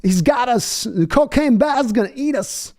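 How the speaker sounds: high, slow, panicky mock Walken; a hammed-up, frightened Christopher Walken; Walken impression. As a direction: ham up a terrified Walken voice. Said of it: "Walken's over there like".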